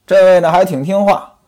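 ordinary talk, nothing marked

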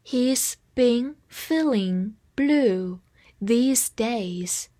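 very slow, word by word with pauses; drawn-out, measured model sentence; slow read-along. Shown as a demonstration. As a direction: read it slowly for learners to repeat. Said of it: "跟我慢读一遍".

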